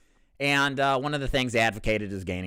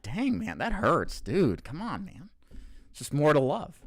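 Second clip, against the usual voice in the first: higher, swooping playful complaint; mock hurt protest; 6 semitones higher than usual. Reacting to five thumbs up on the jibe, mock hurt protest. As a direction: protest as if wounded, pitch rising and falling.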